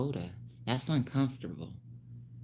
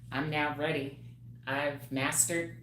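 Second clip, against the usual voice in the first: ordinary talk, nothing marked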